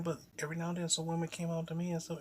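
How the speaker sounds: normal talking voice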